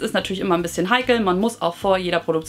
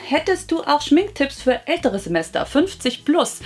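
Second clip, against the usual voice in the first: reading out a viewer question; 6 semitones higher than usual. Acted. Vlogger reads Heike's question to her viewers, read-out voice. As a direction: read it out brightly pitched up, unhurried.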